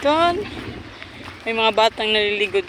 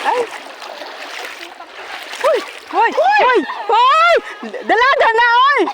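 teasing shout; 14 semitones higher, 5 dB louder than usual. Real, not acted.

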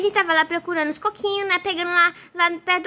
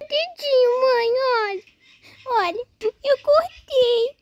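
higher, drawn-out tearful whine; acted crying; 3 semitones higher, much slower than usual. Boy shows mother his cut finger, tearful. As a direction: show the hurt while crying. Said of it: "filho, não chora".

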